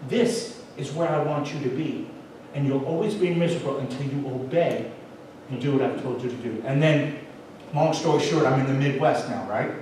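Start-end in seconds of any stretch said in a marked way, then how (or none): 0.1-6.6 gentle voice of God. Retold or imitated. God gently telling the runaway man where to be, tender and soft. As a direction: lower and softer, unhurried and tender. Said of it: "speaks to me ever so gently".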